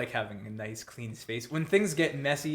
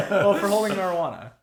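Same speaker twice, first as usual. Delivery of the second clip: louder, higher words squeezed out through laughter; laughing; 8 semitones higher, 10 dB louder than usual. Real, not acted. Laughing about dad's charge.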